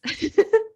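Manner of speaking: sudden sneeze into a laugh; sneeze, then giggle it off; sneezing. Real, not acted. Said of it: "sorry, I'm going to sneeze".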